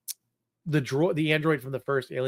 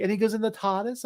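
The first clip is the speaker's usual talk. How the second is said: imitating his kid; 7 semitones higher than usual. Dad imitating his son pestering about the Doctor, higher mock kid voice. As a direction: mimic a small child's excited questions. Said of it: "He's like".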